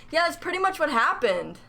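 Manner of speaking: usual speaking voice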